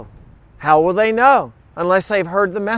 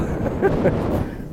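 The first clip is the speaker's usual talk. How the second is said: breathy laugh; 10 semitones higher, 6 dB quieter than usual. Real, not acted. Laughs at own story.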